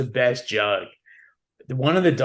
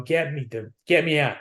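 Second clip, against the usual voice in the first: mock panic; 4 semitones higher than usual. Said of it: "In my mind, I'm going".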